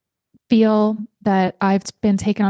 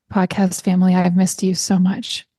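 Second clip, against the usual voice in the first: ordinary delivery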